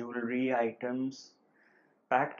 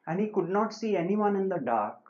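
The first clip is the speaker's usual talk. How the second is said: ordinary talk, nothing marked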